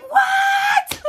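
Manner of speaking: very high, loud shriek of disbelief; mom's shocked shout. Retold or imitated. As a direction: yell it like a mother hearing the news. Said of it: "All you heard on the other line was my mom like".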